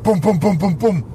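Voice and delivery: loud, pounding bum bum; mock door pounding; knocking noise. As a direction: bang out the knock with your voice.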